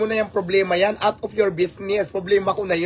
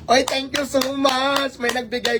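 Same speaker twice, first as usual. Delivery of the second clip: laughing thanks; 9 semitones higher than usual. Real, not acted. Thanking a gift giver on stream.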